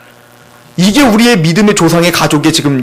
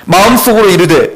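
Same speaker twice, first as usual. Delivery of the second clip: ordinary delivery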